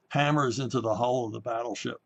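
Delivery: ordinary talk, nothing marked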